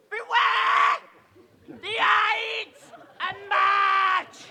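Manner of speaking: slow, loud, drawn-out prophetic warning; soothsayer's cry. Acted. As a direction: wail it like a mad seer.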